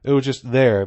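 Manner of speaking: usual speaking voice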